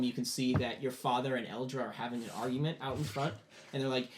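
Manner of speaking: usual speaking voice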